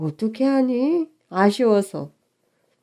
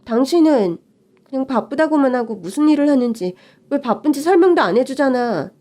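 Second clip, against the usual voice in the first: ordinary talk, nothing marked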